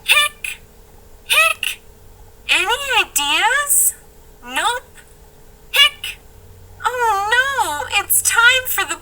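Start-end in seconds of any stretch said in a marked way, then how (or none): 0.0-1.8 hiccup. Acted. [name] hiccuping. 5.7-6.2 hiccup. Acted. [name] hiccuping.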